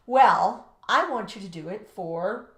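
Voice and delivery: normal talking voice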